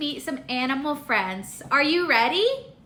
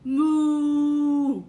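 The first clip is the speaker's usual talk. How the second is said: long, level imitation moo; cow moo. Teacher showing a toddler the cow's sound, long moos. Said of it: "Cow says".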